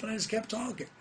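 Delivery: ordinary delivery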